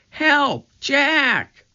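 trapped sister crying out for help; frightened cry for help. A narrator's reading. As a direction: scream from far away in fear. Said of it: "Her cry seemed fainter".

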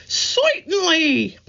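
high squeaky Brooklyn Certainly, drawn out; Brooklyn accent. Acted. Curly sure about something, high squeaky Brooklyn accent. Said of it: "in his thick Brooklyn accent".

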